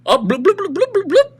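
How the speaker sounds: high, loud rapid bloops; mock siren bleeps. Man charging up to cart-leavers, high mock siren. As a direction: imitate a silly alarm with your voice. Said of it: "orange thing, and he goes".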